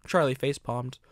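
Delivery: usual speaking voice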